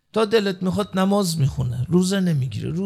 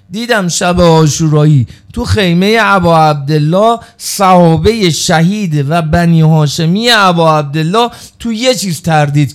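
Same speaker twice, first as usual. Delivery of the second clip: impassioned mourning sermon at its peak; full-force preaching; 11 dB louder than usual. Acted. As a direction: build to a wailing climax for the crowd. Preaching climax in the tent.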